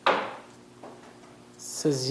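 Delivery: usual speaking voice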